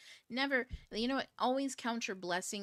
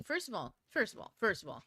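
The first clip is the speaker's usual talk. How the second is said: ordinary delivery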